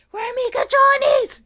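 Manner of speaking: high, loud mock shriek in Spanish; imitated yelling. Aide replays the patient's demand amid laughter, loud high mock yell. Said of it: "She's yelling".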